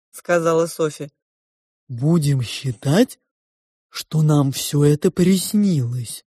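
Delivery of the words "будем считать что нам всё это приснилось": slow, drawn-out character voice; probing two-faced Sofya. A narrator's reading. Sofya questions the girl about her parents, probing. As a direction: probe slowly, stretching the words.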